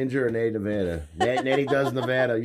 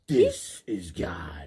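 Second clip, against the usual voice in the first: Freddy Krueger voice; 7 semitones lower, much slower than usual. Retold or imitated. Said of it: "Freddy's one fucking saying".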